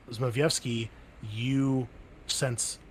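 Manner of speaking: usual speaking voice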